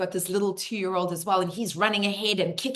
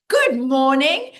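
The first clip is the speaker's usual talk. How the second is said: cheery greeting; 6 semitones higher, 10 dB louder, slower than usual. Retold or imitated.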